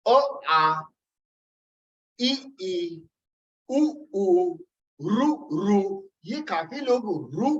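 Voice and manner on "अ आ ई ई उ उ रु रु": अ आ ई ई उ उ रु रु each sounded clearly, रु रु slowed at the end; vowel recitation. Shown as a demonstration. Teacher recites vowel row for students, slowing on the last pair. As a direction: recite अ, आ, ई, ई, उ, उ, रु, रु as a model for learners. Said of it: "अ से रु तक हम रुकते हैं".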